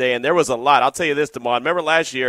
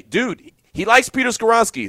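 higher, exasperated aside; higher, fed-up dude; 9 semitones higher, 4 dB louder than usual. Retold or imitated. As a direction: blurt out the obvious answer in disbelief. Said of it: "I wanted to say".

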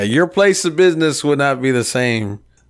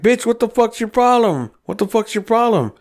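high-pitched, heated challenge; retold angry confrontation; 9 semitones higher than usual. Storyteller confronts laughing Art over the burnout, heated high challenge. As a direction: act out squaring up to someone.

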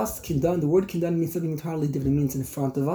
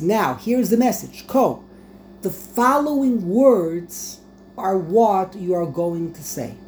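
raised-voice command; 4 semitones higher, 5 dB louder than usual. Acted. Yaakov instructing his messengers how to address Esav, raised-voice proclamation. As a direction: proclaim it higher and louder, slowing on the message. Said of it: "I want you to raise your voice".

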